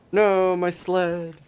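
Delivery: high, slow, drawn-out wail; mock lament. Real, not acted. Gamer mock-mourning the vanished sled, hammed-up high wail.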